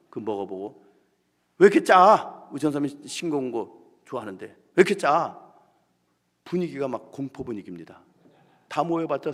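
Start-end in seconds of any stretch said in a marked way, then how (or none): 1.6-2.5 louder, higher snapping complaint; nitpicking shout. Retold or imitated. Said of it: "트집을 잡는 거예요". 4.5-5.4 repeated angry complaint. Retold or imitated. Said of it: "트집을 잡는 거예요".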